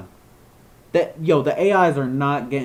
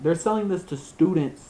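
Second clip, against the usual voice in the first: ordinary talk, nothing marked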